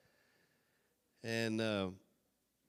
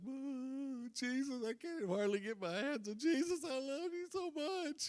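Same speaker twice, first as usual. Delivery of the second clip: put-on weepy big baby; 12 semitones higher than usual. Preacher imitating himself moved to tears at the conference, high weepy gushing. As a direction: gush like an overwhelmed crybaby. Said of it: "I just look like a big baby".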